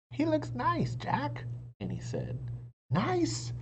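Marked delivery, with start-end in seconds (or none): 0.1-1.4 lightly voiced Annie. 2.9-3.5 incredulous. A narrator's reading. Jack incredulous.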